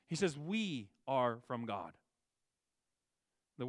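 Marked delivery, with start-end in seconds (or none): 0.4-1.9 slow, weighty "We" leaned on hard; stress on we. Shown as a demonstration. Pastor showing the congregation that John stresses "We", slow, leaned-on word. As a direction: press down on "We" and slow the whole line. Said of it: "the emphasis here is we".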